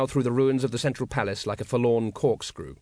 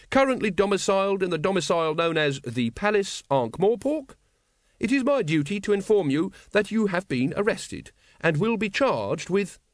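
high, earnest constable's recital; formal, pitched-up police caution; official reading; 7 semitones higher than usual. Acted. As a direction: recite it by the book. Carrot formally arrests Wonse.

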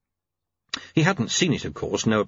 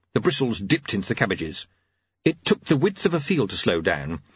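usual speaking voice

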